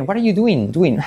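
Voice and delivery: fussy textbook "What are you doing", ending in "du-in"; overcorrect, a bit prissy. Shown as a demonstration. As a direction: say "doing" precisely, as "DU-in". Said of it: "hacerlo muy bien".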